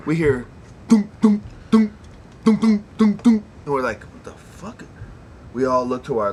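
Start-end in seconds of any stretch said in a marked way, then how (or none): 0.9-3.4 loud, high mock banging; banging sound effect. 4.0-4.8 a hushed, startled what the fuck; startled whisper. Retold or imitated.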